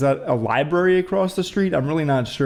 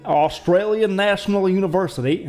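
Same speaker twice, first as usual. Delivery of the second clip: bad Australian accent; 5 semitones higher than usual. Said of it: "that was not Australian".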